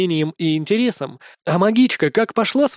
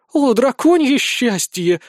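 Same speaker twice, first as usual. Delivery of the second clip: high, drawn-out swoon; moaning; 6 semitones higher, 3 dB louder, slower than usual. A narrator's reading. Swooning crowd at the news, moaning. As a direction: moan it in rapture, stretching the words. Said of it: "стонут".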